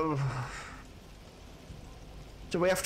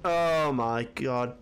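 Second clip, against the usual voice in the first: usual speaking voice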